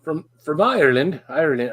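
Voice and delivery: shaky stab at an Irish accent; attempted Irish accent. Shown as a demonstration. Trying to say where Thomas is from, tentative Irish accent. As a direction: try out an Irish accent, unsure of it. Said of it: "I wanna do an Irish accent".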